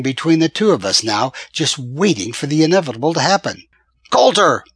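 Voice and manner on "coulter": loud, high yell across the street; angry bellow of a name. A narrator's reading. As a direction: shout his name after him. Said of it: "he yelled".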